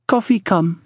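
put-on high accented woman's voice; voiced Pearl. A narrator's reading. Pearl announcing coffee from the kitchen, high voice. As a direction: pitch it up for the woman.

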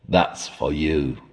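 slow, sly and soft with a grin; malicious grin. A narrator's reading. Guide telling the traveller the master calls, malicious grin. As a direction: relish the bad news. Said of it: "with a malicious grin".

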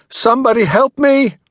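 high, loud desperate cry; calling out for help. A narrator's reading. Tara calls out to strangers for help, a high loud cry. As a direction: scream for anyone to hear. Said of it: "She called out".

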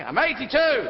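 high, loud proclamation of age; old lady shouting. Acted. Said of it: "where they start shouting".

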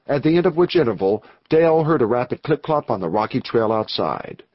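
usual speaking voice

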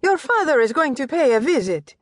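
high, lilting mother announcing news; Italian mother's voice. Acted. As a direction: voice the doting Italian mistress. Mother Anna Maria speaks.

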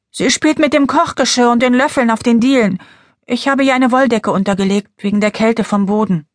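put-on young girl voice. Clara speaks, child sister.

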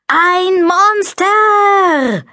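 long drawn-out high scream. A narrator's reading. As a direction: scream it with full breath. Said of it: "holte tief Luft und schrie". (lang gezogener Schrei)

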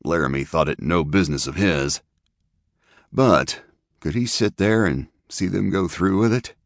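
ordinary delivery

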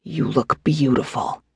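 soft, tender coo. A narrator's reading. Husband to pregnant wife, cooing. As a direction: coo it softly to soothe her. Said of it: "he cooed at her".

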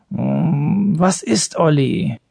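drowsy half-awake mumble; sleepy grumble. A narrator's reading. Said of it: "brummte Thorolf verschlafen".

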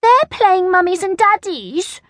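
put-on high little-girl grumble; grumbling. A narrator's reading. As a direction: complain like a put-out child. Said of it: "grumbled Angela".

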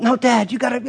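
put-on impatient kid. Son cutting off his dad about the game, high and impatient. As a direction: brush off the question.

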